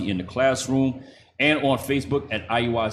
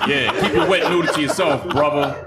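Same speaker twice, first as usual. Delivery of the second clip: loud, high laughing tease; 4 semitones higher, 4 dB louder than usual. Real, not acted. Teasing horny boys.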